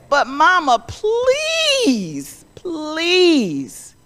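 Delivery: high crying plea; pleading child. Acted. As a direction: beg Mama, voice cracking.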